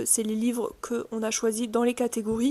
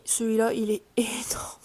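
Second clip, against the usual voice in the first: hushed, laughing whisper about a huge book; whispered aside about a huge book; amazed whisper; 5 dB quieter than usual. Real, not acted. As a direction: breathe it out in awe with a little laugh.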